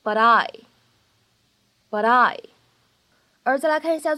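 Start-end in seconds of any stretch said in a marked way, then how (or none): 0.0-2.4 "but I" 连读，t 发成闪音; linked with a flap. Shown as a demonstration. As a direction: glide "but I" as one word with a flapped t. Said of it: "我们呢可以读成是but I".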